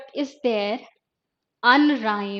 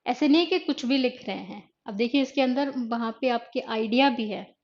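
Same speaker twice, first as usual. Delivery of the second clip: ordinary talk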